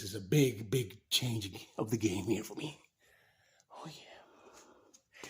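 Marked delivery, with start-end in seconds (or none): 3.7-5.2 whispering. Real, not acted. Whisper under car.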